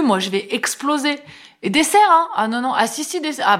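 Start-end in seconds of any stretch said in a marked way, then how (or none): 1.6-2.3 high, eager push for dessert; pushy host. Retold or imitated. David propose le dessert. 2.7-3.4 high, overriding insistence; insisting host. Retold or imitated. David insiste encore.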